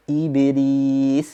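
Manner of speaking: iblis with the b bounce sounding like bi; iblis, b followed by an i vowel; wrong take, like ibilis; qalqalah mixed with i. Shown as a demonstration. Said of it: "seperti iblis".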